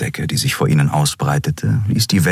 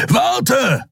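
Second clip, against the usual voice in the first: much higher, urgent call; calling after him. A narrator's reading. Kero calling after the fleeing Kai, urgent. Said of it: "rief Kero dem Menschen hinterher".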